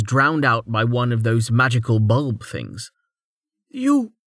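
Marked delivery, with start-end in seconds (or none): 3.7-4.1 high, weak accusing cry; slurred exclamation. A narrator's reading. Dazed woman recognizing the figure in the doorway, slurred exclamation. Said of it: "she slurred".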